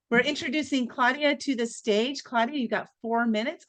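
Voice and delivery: ordinary talk, nothing marked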